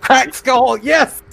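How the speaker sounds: high cheer at a great roll; excited cheer. Real, not acted. Player cheering his critical injury roll, excited. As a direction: whoop at the crit result.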